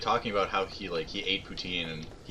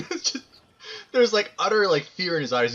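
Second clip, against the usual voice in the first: high and loud through laughter; laughing; 11 semitones higher, 7 dB louder than usual. Real, not acted. Streamer laughing while telling of the roommate's fear. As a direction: blurt it out while cracking up.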